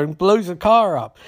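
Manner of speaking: normal talking voice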